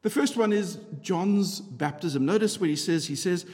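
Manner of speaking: ordinary delivery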